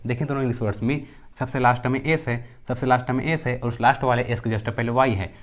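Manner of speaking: ordinary delivery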